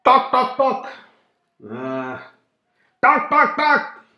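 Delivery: high loud talk talk knock; sharp high knocking calls; knocking noise. Acted. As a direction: imitate a crow tapping glass. Crow knocking on window.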